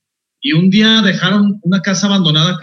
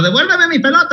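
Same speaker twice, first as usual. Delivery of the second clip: put-on kids yelling from rooftop; 12 semitones higher than usual. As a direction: yell it across the rooftops like a kid. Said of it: "les gritaba".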